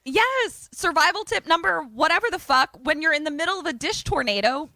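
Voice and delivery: high, emphatic survival lecture; exasperated rant. Real, not acted. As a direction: rant the advice like it's blindingly obvious. Mock ranting survival advice.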